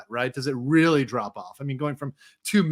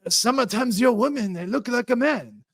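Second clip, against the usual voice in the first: put-on Japanese accent; 7 semitones higher than usual. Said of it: "It's like".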